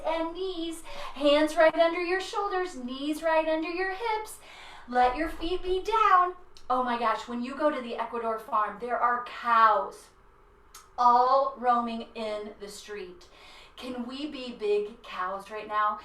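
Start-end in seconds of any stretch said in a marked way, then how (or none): none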